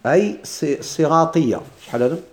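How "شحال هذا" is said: ordinary talk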